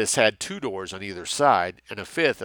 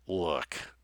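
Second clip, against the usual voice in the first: lame; 3 semitones lower, 6 dB quieter than usual. Acted. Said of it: "Doe began lamely".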